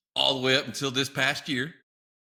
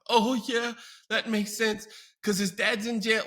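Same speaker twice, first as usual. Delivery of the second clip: high, breezy overshare; mother casually sharing too much; chirpy mother; 9 semitones higher than usual. Retold or imitated. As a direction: toss it off lightly, pitched up.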